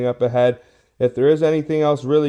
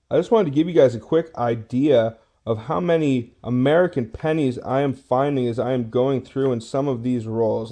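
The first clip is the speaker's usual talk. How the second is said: usual speaking voice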